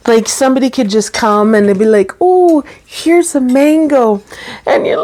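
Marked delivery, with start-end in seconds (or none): 2.2-4.2 high, lilting mock-sweet offer; cheery offer. Somebody offering fruit to a weepy pregnant woman, cheery offer. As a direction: sing out the offer lightly, much higher.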